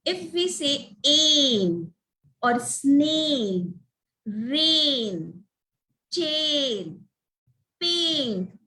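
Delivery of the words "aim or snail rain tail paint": "aim", "snail", "rain", "tail", "paint" with long drawn-out "ay"; stretched A sound. Shown as a demonstration. Teacher showing where A sound falls, vowels stretched. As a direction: stretch the vowel in each word, pausing between. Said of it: "I could hear the A sound".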